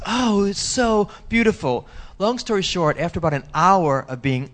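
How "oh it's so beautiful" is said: awestruck. Retold or imitated. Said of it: "he goes".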